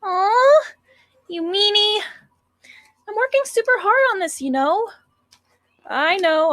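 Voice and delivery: put-on high, whiny cute girl. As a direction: pout playfully like a sweet schoolgirl. Sayori's voice.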